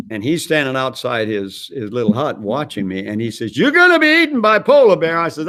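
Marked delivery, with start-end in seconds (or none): 3.6-5.2 loud, very high mock warning; alarmed call. Operator outside his hut warning the departing man, alarmed and loud.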